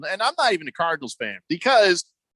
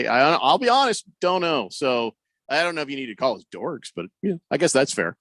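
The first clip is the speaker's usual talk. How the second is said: ordinary talk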